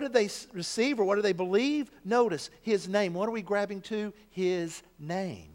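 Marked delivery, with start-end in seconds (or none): none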